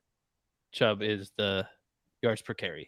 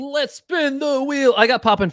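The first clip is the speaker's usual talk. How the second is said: hype announcer; 12 semitones higher, 4 dB louder than usual. Real, not acted. Host hyping the giveaway wheel.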